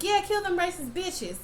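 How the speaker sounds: high gleeful egging on; gleeful cheer. Real, not acted. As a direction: cheer the monsters like a sports fan. Cheering the monsters on.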